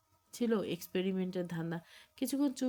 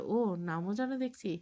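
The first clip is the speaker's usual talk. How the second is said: usual speaking voice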